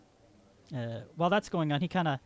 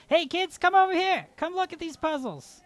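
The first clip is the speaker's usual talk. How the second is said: higher, louder call across the room; calling to kids; 14 semitones higher, 8 dB louder than usual. Pretend. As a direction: hail the kids over, loud and high. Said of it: "We just ha-, say".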